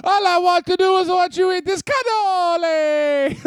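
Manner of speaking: very high, cartoon Italian accent; hammed-up mock Italian, held high; Dominic Tonucci. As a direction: play an excitable Italian wrestling trainer. Continues Italian trainer impression.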